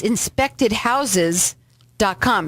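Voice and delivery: slow, each word spelled out; slow and clear. Real, not acted. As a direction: spell the website out slowly for listeners. Host spells out the website.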